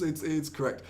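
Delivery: ordinary delivery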